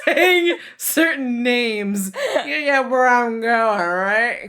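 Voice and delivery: giggling high through the words; laughing while talking. Real, not acted.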